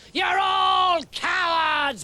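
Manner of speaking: slow, high, bawled insult; Goliath bawling scorn at the army; bawling giant. Acted. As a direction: hurl it across the valley like a giant. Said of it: "bawled Goliath".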